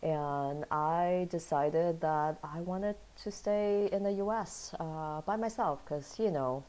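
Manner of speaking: ordinary talk, nothing marked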